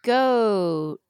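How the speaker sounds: "Goat" stretched long on the vowel; slow, elongated "Goat"; drawn out. Shown as a demonstration. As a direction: hold the vowel in "Goat" for seconds. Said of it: "say it so it takes three seconds".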